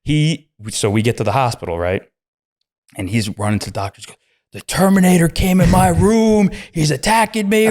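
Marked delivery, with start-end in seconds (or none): 4.5-7.7 panicked yell. Retold or imitated. Kid panicking to the doctors.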